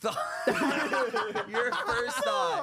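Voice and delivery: high squeal through laughter; cracking up mid-sentence, voice shooting up; laughing hard while trying to talk. Real, not acted. Laughing at the psychotic story.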